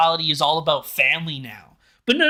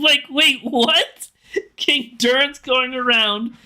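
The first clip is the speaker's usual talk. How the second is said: disbelief; 5 semitones higher, slower than usual. Real, not acted. Stunned by Durin plot twist.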